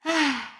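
overdone mournful sigh; exaggerated sigh. A narrator's reading. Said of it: "就夸张地叹了一口气".

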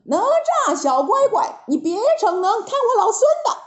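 Monkey King's rising battle cry; shouted challenge. A narrator's reading. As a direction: call out the boy rival with swagger. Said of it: "他大叫一声".

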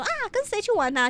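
put-on high, sweet old-lady question; lightly voiced grandmother; grandma asking. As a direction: lift it up like a curious grandma. Grandma asks who's going.

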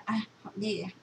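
normal talking voice

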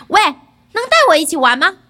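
loud, quick, eager request; eager calling out. A narrator's reading. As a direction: call out to join the game. Boy calls out to monsters. (响亮急切地喊话)

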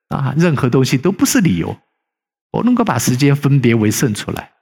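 usual speaking voice

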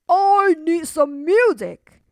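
put-on a giant hunting for a boy, booming. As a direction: sniff the air like a hungry giant. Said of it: "he said".

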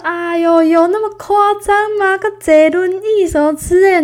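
high, loud mocking tease; laughing teasing. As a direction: laugh it off as overblown. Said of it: "她真的一直笑说".

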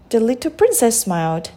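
plain reading voice

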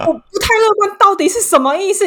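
high, loud freaked-out question; retold alarm. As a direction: demand an answer in comic panic. Reliving alarm at vague bad news.

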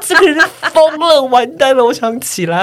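high, loud mock panic; hammed-up terror; panicked. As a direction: freak out in the chair. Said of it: "我就说".

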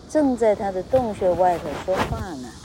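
plain reading voice

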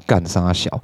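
inner grumble. Retold or imitated. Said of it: "心里啦".

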